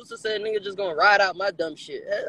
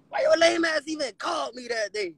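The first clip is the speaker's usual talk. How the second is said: high-pitched, outraged retold shout at the friend; exasperated yell; 9 semitones higher than usual. Mock yelling at the friend who got him locked up, outraged. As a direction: yell it like he ruined your life.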